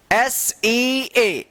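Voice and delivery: एस ई ए drawn out letter by letter; slow spelling. Shown as a demonstration. Teacher spells for learners, एस ई ए stretched slowly. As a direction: spell एस ई ए with each name stretched.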